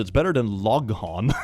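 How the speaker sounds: ordinary delivery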